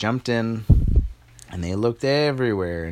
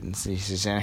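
ordinary delivery, nothing marked

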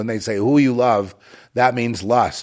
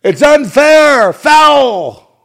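high, drawn-out protesting shout; long, loud wailing yell; screaming and yelling; 13 dB louder, much slower than usual. Acted. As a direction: scream it out slowly at full volume, acting out a dying protest. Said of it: "you'll die screaming and yelling".

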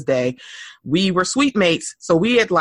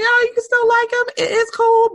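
breezy reassurance. Retold or imitated. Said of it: "it's like".

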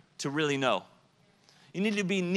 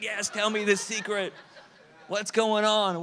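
put-on higher, eager playground kid; 6 semitones higher, 3 dB louder than usual. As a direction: beg for the secret like a child. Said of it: "It's like".

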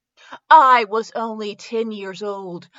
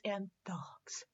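breathy whisper under the breath; whispered title; 4 semitones lower, 22 dB quieter than usual. Shown as a demonstration. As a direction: hush the last word like a secret. Dramatic title end.

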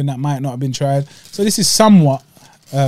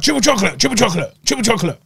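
higher, chanting triple chocolate; mock friend's voice; 5 semitones higher, faster than usual. Mocking his friend's donut craving, lightly put-on voice. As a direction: tease him by parroting his craving. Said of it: "Why are you making me sound".

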